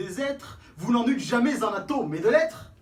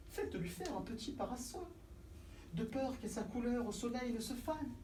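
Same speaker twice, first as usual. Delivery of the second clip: much softer, tender concern; 2 semitones higher, 13 dB quieter than usual. Acted. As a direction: coo it gently.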